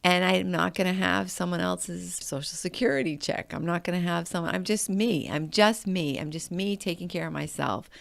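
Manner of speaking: normal talking voice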